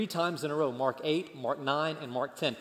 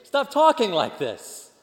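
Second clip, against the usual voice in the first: loud, high-pitched indignant scolding; outraged rebuke; 6 semitones higher, 8 dB louder than usual. Acted. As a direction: rebuke him, voice leaping up in disbelief. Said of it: "he began to rebuke Jesus".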